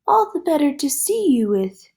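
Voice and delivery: wolf in granny disguise, put-on high squeak; high funny voice. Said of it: "in his high, funny voice".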